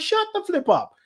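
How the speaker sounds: high mock exasperation; sassy shut-up; exasperated retort. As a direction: snap back in sassy disbelief. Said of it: "I'm like".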